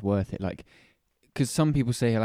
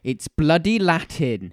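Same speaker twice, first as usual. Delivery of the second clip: put-on Welsh accent; 3 semitones higher, 6 dB louder, slower than usual. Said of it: "Why have you gone Welsh accent?".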